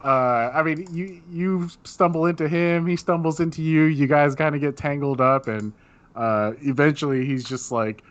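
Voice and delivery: usual speaking voice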